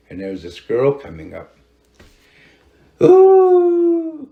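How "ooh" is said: a long, high, loud wailing "ooh" in an eerie voice; imitated wailing cry. Storyteller imitating the crying woman in the dark, eerie high wail.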